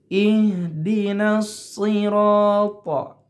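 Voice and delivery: slow melodic chanting of Iyyaka na'budu wa; Quran recitation. Acted. Teacher reciting the verse for students, slow chant. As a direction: chant it like tajwid recitation, drawn out.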